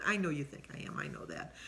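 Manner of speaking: playful whispered aside. Real, not acted. Vlogger whispers a teasing aside to her audience, hushed. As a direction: whisper it like a secret to viewers.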